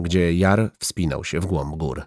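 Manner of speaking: plain reading voice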